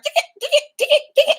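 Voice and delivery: high mechanical stutter like a shorting computer; robot glitch. Pretend. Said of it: "you feel like a robot".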